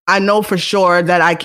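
normal talking voice

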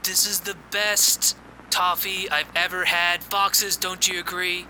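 high, soft, slow Trump voice; breathy mock Trump drawl; Donald Trump impression. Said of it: "we got a clip of that".

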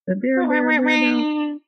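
high imitated horn blasts; botched air-horn imitation. Shown as a demonstration. Host attempts a sound effect she is bad at, imitating. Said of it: "I'm really bad at making that sound".

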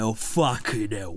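slow, low mock Cockney; Cockney accent. Shown as a demonstration. Listing British accents actors do, drawled Cockney. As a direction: draw it out in put-on Cockney. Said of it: "That's Cockney, innit?".

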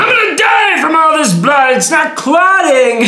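high, loud dying wail; yelling. Acted. Pretending to bleed to death before his annoyed friend, yelling. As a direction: scream in mock agony, dragging the end. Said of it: "Stop yelling!".

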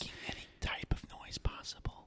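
soft unvoiced whisper. Shown as a demonstration. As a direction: whisper close into the mic. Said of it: "whispering into the mic like this".

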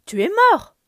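a cry of disbelief at a ghost; shocked shout. A narrator's reading. Scar sees the dead king.

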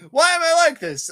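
high, loud hammed-up wail; mock anguished cry. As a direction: cry out like a melodramatic hero. Hero's outburst in retelling.

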